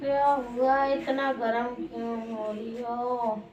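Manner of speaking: slow, low and hushed; soft soothing. Acted. Asking an angry partner why she is so heated, soft soothing. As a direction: draw it out softly and slowly.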